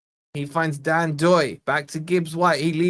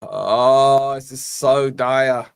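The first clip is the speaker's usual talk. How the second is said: despairing groan; 6 semitones lower, much slower than usual. Real, not acted. Despairing at a dull match.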